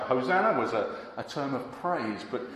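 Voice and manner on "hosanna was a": normal talking voice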